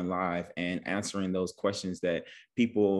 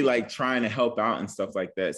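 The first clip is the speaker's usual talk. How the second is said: usual speaking voice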